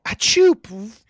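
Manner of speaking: fake sneeze.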